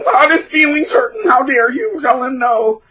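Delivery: high, loud mock-outraged whine; mocking a thin-skinned crybaby at full pitch; whiny sissy. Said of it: "this one little sissy".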